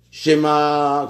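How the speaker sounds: "schéma" with the final A held long on one level note; drawn-out syllable. Retold or imitated. Teacher imitating a frequent praying error, "schéma" drawn out. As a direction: stretch the last vowel of "schéma": schémaaa. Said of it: "les gens rallongent les".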